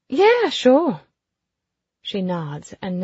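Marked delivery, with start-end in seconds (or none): 0.1-1.0 high, loud easy agreement; breezy brash lady. A narrator's reading. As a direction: chirp it carelessly.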